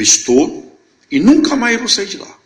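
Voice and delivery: high, loud, resolute declaration; emphatic conviction. Retold or imitated. Friend answering about staying in the church, emphatic. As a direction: declare it with ringing certainty.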